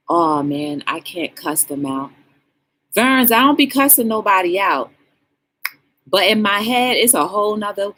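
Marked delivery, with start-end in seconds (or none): none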